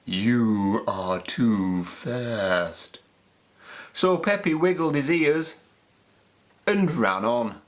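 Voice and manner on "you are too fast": very slow, lower snail drawl. A narrator's reading. Snails turn Peppy away, very slow low voice. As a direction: drag each word like a snail. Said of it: "they snorted".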